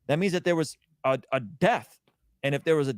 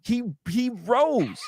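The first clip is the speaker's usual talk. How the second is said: higher, slow and louder, every word driven home; fervent proclamation; 8 semitones higher, 3 dB louder, slower than usual. Real, not acted. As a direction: preach it like the tomb is truly empty. Insists on the resurrection in debate.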